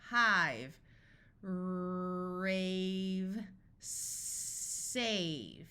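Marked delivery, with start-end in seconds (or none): 1.4-5.7 first consonant held long, then gliding into the vowel; drawn-out phonics blending; sounded out. Shown as a demonstration. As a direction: stretch each word slowly through its sounds.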